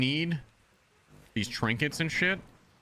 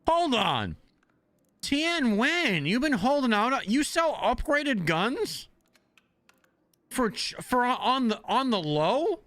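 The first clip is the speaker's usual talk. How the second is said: mock betrayed surprise; 10 semitones higher, slower than usual. Real, not acted. Vendor secretly sells upgraded guns.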